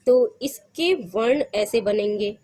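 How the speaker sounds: ordinary talk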